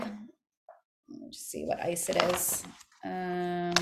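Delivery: whispered aside, slow. Real, not acted. Teacher checking the book page aside, whispering. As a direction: whisper it to yourself while searching.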